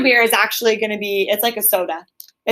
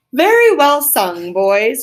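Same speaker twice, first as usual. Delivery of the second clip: hearty and louder, slowly; hearty cry; 4 semitones higher, 7 dB louder, slower than usual. Acted. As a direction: cheer the singers on heartily. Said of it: "cried the rat heartily".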